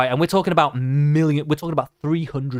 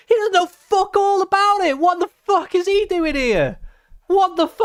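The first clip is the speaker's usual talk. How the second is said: high-pitched incredulous outburst; real outraged disbelief. Real, not acted. Streamer ranting at an unqualified YouTuber, high incredulous outrage. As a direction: squawk it high, appalled at his nerve.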